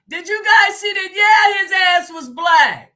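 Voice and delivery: loud, high, astonished outburst; excited shout. Real, not acted. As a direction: shout it out in disbelief. Reacting live to a viewer's video.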